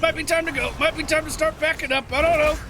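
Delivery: high, tense and worried; backing off nervously. Real, not acted. Retreating under pressure.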